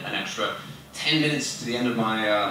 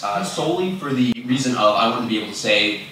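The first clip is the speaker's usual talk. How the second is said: ordinary delivery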